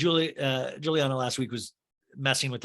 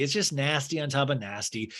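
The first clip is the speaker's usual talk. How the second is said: normal talking voice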